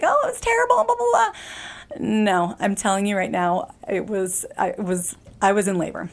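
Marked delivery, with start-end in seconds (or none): 0.0-1.3 much higher, hammed-up complaining; mock whiny pregnant woman. Mocking how pregnant women supposedly complain, hammed-up whine. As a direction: parody an overdramatic mom-to-be. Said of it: "pregnant women can be like".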